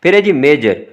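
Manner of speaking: usual speaking voice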